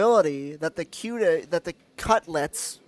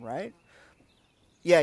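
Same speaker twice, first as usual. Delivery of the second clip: usual speaking voice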